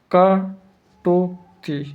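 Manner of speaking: कटुक्ति stretched very slowly, every sound held long; drawn-out demo. Shown as a demonstration. Teacher showing students how to write कटुक्ति, very slowly. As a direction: drag out कटुक्ति slowly as if writing it on the board. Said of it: "कटुक्ति ऐसे लिखेंगे".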